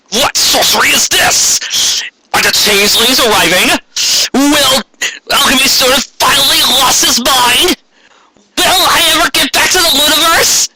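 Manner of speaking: high, hammed-up trailer announcer; dramatic teaser voice. Teasing the next episode to the audience, hammed-up announcer. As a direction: ham up the cliffhanger questions.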